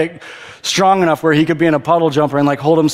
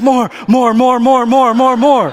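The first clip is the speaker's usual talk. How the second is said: gleeful chant of more, a bit louder; a toddler begging for another go; excited kid; 5 semitones higher, 3 dB louder, slower than usual. Retold or imitated. Son pops up from the water begging for more, gleeful. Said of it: "He's like".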